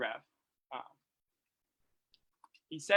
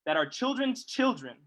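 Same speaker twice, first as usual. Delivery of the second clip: solemn reading; 5 dB louder than usual. Acted. Speaker reads a Du Bois passage to the audience, solemn. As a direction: read it like scripture.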